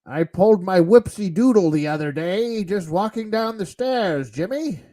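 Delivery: lightly voiced old geezer; a hint of a doddering old man; old man Sean Connery voice. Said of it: "weird, like, old man Sean Connery awkward voice".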